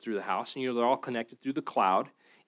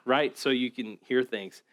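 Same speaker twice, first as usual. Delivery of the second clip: usual speaking voice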